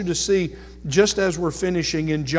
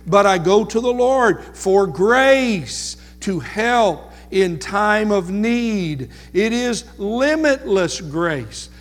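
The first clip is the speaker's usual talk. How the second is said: preaching at full force; 4 semitones higher, 4 dB louder than usual. Acted. Preacher lifting the congregation toward grace, preaching fervently. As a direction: build the praise of grace higher and louder. Building sermon climax.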